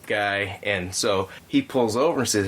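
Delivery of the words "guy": ordinary delivery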